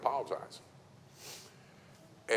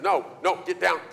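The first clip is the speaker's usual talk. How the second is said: raised, higher mock scolding; mock yell; 9 semitones higher, 5 dB louder than usual. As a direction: snap at the kid, hammed-up. Speaker scolding child, retold.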